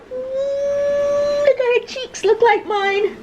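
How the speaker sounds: very high doting coo. Real, not acted. Mom cooing at her baby photo, very high.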